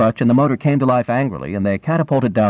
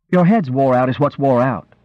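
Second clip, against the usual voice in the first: higher sharp scolding snap; scolding retort; 4 semitones higher than usual. A narrator's reading. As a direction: snap back at him. Wife retorts.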